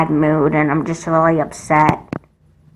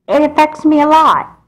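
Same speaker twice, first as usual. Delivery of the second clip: higher, louder upset protest; upset outburst; 9 semitones higher, 3 dB louder than usual. Acted. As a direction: blurt it out, hurt and frustrated. Upset twin arguing with mom.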